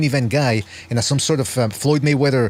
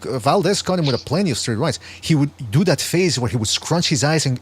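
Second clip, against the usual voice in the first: usual speaking voice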